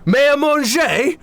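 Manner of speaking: can't believe what he hears; shocked. Acted. Chef shocked by the news.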